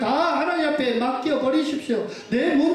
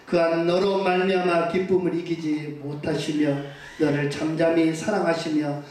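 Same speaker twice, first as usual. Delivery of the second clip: scripture reading; 6 semitones lower than usual. Acted. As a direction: read the verse gravely, a notch lower. Scripture read aloud. (낮고 느린 성경 낭독)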